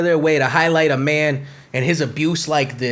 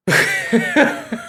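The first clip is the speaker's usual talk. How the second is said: high burst of laughter. Real, not acted. Laughing at his own mixed breeding joke, high laugh. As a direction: crack up at your own joke.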